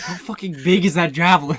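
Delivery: loud astonishment. Real, not acted. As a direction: blurt it out in loud disbelief. Shocked at the giant javelin.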